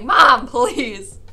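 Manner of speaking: loud, begging outburst with a laugh; loud retold plea. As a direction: cry out to mom, half laughing. Acts out begging her mom.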